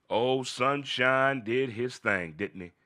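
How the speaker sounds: very slow, savoring each word; slow drawn-out. Real, not acted. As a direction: stretch it out with relish.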